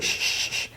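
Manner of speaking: soft repeated shush; retold doctor's hushing; imitated shushing. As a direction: hush someone with three quiet shh sounds.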